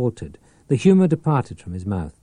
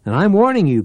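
higher, louder furious warning; snapped warning; 10 semitones higher, 7 dB louder, faster than usual. A narrator's reading. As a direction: bark the warning. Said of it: "Powell snapped".

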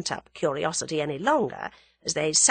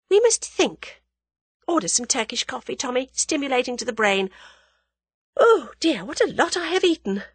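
high-pitched, lively female character; young woman character, decisive; 8 semitones higher, 4 dB louder than usual. Acted. Young woman ordering coffee after a big meal, decisive. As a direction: announce it decisively, then lament lightly.

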